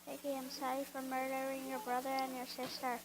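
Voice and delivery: usual speaking voice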